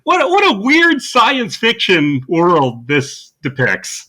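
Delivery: hammed-up incredulity; mock astonishment. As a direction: feign total shock, laying the irony on thick. Ironic shock at a familiar event.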